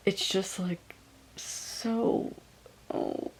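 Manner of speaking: real welling-up, halting then spilling out; on the verge of crying. Real, not acted. Reader overcome by a book that feels so real, tearful. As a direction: voice faltering, then a teary laugh. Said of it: "gonna start crying".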